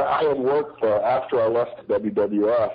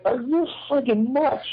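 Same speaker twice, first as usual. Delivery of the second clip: incredulous retort; 14 semitones higher than usual. Retold or imitated. Retold incredulous answer to promoter.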